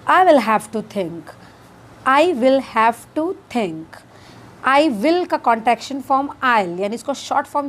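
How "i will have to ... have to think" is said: slow teaching demo. Shown as a demonstration. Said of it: "तो English में इसे कहेंगे".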